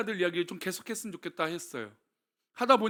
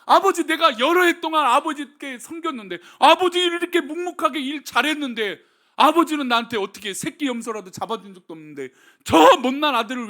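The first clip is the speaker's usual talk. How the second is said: elder son's complaint; 9 semitones higher, 11 dB louder than usual. Acted. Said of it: "그리고 이렇게 말합니다".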